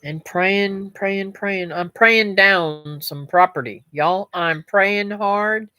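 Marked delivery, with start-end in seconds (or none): none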